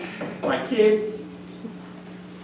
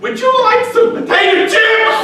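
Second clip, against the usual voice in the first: squeaked out much higher and louder through sobs; sobbing; 8 dB louder than usual. Acted. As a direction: offer chips while weeping, voice cracking high. Host sobbing while offering snacks.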